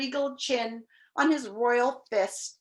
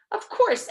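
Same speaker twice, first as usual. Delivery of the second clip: "Of course" pitched high and loud; emphatic agreement; 12 semitones higher, 5 dB louder than usual. A narrator's reading. Bug backing the king, emphatic high "Of course". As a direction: hit "Of course" hard and high. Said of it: "emphasized the bug".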